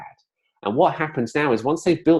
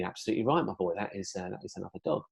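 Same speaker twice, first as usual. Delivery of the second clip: put-on gentle praise for a toddler; 3 semitones lower, 11 dB quieter, faster than usual. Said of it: "And he'd be".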